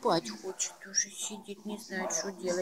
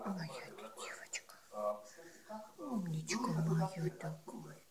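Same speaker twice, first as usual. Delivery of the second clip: tender whisper; 2 semitones lower, 7 dB quieter than usual. Real, not acted. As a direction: whisper lovingly to a pet. Soothing the pet. (нежный шёпот)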